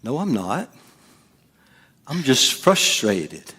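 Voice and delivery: softly insist, holding it in; quiet, drawn-out denial in a mock-calm retelling; restrained mock denial; denying anger. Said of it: "Well, you're getting angry".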